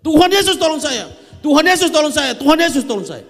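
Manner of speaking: re-enacted full-volume, high-pitched cry for help; loud desperate shout. Dying patient calling on Jesus to save him, loud high shout. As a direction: shout it three times at the top of your voice. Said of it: "saya langsung teriak kencang-kencang".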